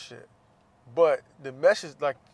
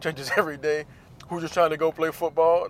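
normal talking voice

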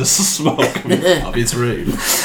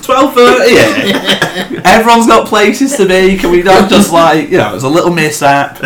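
laughing appeal; 7 semitones higher than usual. Retold or imitated. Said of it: "I'm like".

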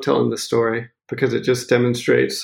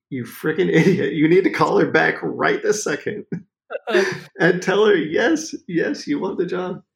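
higher, half-laughing scold from the wife; laughing mock scolding; 6 semitones higher than usual. Retelling the wife scolding him, laughing mock scold. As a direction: tease him as an idiot through laughter.

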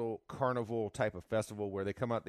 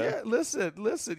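put-on a touch of Caribbean island accent, much higher; 9 semitones higher than usual. Friend leaning into island accent after being teased, higher. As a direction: say it like a Red Stripe ad islander. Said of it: "a little island accent going on".

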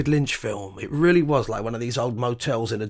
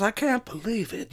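a hint of an elderly lady in disbelief, higher; lightly voiced incredulous old lady; 8 semitones higher, 6 dB quieter than usual. Receptionist tells the band she cannot believe it, mildly voiced old lady. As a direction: gasp it out in mild disbelief. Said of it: "she was incredulous".

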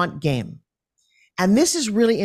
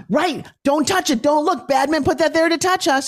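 mock alarm; 8 semitones higher than usual. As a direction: warn like it's a spooky game. Mock warning game with kids.

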